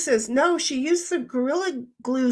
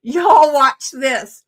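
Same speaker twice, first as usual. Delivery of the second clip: put-on Southern accent; 2 semitones higher, 9 dB louder, much slower than usual. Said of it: "with a Southern accent saying".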